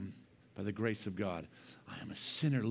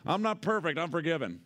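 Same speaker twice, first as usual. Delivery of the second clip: high mock of a bumper sticker; 6 semitones higher, 4 dB louder than usual. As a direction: parrot the slogan mockingly. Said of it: "You like that bump sticker?".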